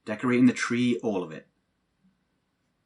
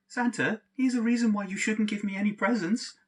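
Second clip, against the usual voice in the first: a higher, lightly voiced sing-song kid reading the letter; mock child letter; 10 semitones higher than usual. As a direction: parody the naughty-list letter. Mocking the mum's letter format.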